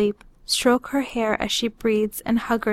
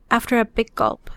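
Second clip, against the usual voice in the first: usual speaking voice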